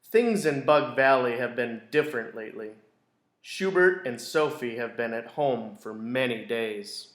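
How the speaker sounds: gentle storybook narration; teacher reading a picture book to a class; reading to children. A narrator's reading. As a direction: read it like circle time for kids. Reads picture book aloud.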